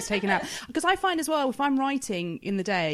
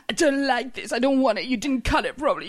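higher, louder petulant complaint; fussy child; 5 semitones higher, 7 dB louder than usual. Retold or imitated. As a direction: complain like a picky eater. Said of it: "she does the".

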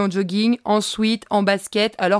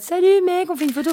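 high, chirpy fan voice asking for a photo; mock fan greeting; 9 semitones higher, faster than usual. Teasing herself, imitating a fan asking a star for a photo. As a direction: play the gushing fan you didn't want to be. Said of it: "en mode".

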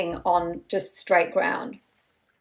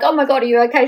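mock concern; 5 semitones higher, 6 dB louder, much faster than usual. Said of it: "She's not like".

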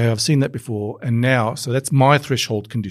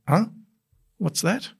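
a high, soft "huh, what's that?"; sudden curiosity, pitched up and hushed; puzzled surprise; 7 semitones higher, 4 dB quieter than usual. Retold or imitated. Scanner spotting something odd, high puzzled surprise. Noticing a signal that jars.

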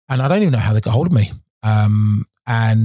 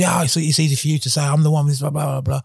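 higher, whiny complaining; moaning; 6 semitones higher than usual. Retold or imitated.

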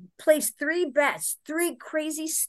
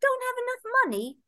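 much higher, fast, concerned partner voice; imitating Phil; 12 semitones higher, faster than usual. Her partner asking about her money, high and quick. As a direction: ask with caring surprise. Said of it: "He goes".

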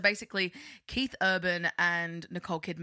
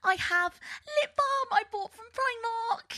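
put-on much higher, slow girly vlogger voice; YouTuber voice. Mocking Zoella's old vlog intro, very high and slow. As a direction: gush like a YouTuber showing her bag. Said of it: "Zoella being like".

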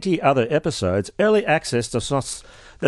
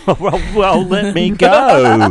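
hammy plea; 5 semitones higher, 6 dB louder, slower than usual. Pretend. Dramatic plea joke.

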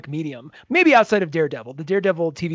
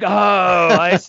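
slow, louder, drawn-out mock realization; dawning realization; 6 dB louder, much slower than usual. Viewer finally understanding who she is, slow realizing. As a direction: let it sink in slowly.

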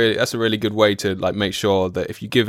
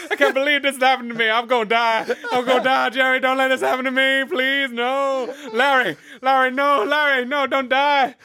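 much higher, laughing, wailing demon; panicking demon. Retold or imitated. A burning demon pleading with Jerry, high panicked wail. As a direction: squeal like a doomed little demon begging its friend. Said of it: "one of them was like".

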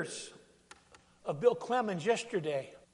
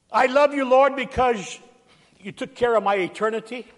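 "I love you, Lord" much louder and higher, the last words fast; loud proclamation; 5 semitones higher, 10 dB louder than usual. Shown as a demonstration. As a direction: shout "I love you, Lord" out to the whole church. Repeats the line at full force.

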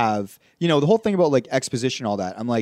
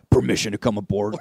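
lower-pitched movie-star impression; lightly imitated, low film-hero line; Jason Momoa; 6 semitones lower than usual. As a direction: drop the voice lightly into character. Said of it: "Do your, uh, impression".

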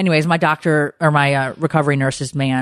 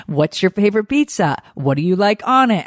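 put-on higher-pitched, as if talking to a child; childish talk imitation; 6 semitones higher than usual. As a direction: imitate someone humouring a little kid. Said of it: "it was, like, so childish".

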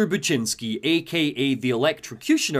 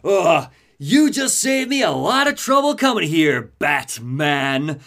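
Electrocutioner; 3 semitones higher, 5 dB louder, slower than usual. Acted. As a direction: roar a gloating villain's threat. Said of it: "he appears and he's like".

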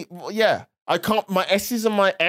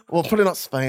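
normal talking voice

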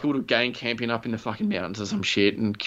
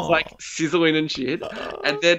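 ordinary talk, nothing marked

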